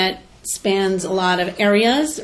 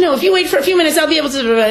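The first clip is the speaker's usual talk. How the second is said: higher, louder mock-belligerent snap; 8 semitones higher, 6 dB louder, faster than usual. Mother retelling the florist's reply, mock belligerent. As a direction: imitate a grudging, irritated shop lady. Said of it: "in the same belligerent tone of voice".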